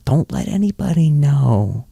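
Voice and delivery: slow hushed secret, sinking into a whisper; whisper chat. Acted. Fan confides in fellow Colts fan, whisper chat. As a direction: let them in on it in a conspiratorial whisper. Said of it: "like, a whisper chat".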